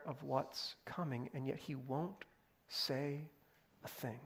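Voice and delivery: fading to almost nothing on "say a thing"; dropping to a hush. Acted. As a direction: let it trail off into silence. Preacher drops to near silence.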